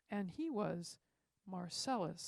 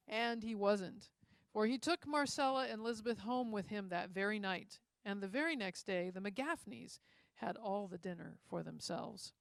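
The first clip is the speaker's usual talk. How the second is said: ordinary delivery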